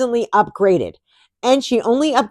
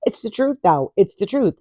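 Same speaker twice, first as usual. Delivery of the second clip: usual speaking voice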